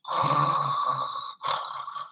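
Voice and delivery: long soft sigh; content sigh. Acted.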